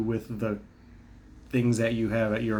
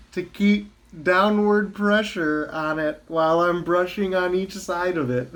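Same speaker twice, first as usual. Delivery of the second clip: sarcastic; 8 semitones higher, 4 dB louder than usual. Real, not acted. Mock promise to dentist.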